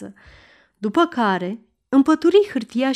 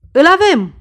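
howling in triumph; 2 semitones higher, 7 dB louder, much slower than usual. Acted. Surgeon Tickler announcing the order to the crowd, a triumphant yell. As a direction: roar it out, waving the paper overhead. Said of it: "urla el".

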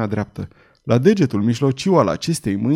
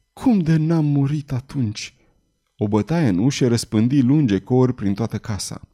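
ordinary delivery

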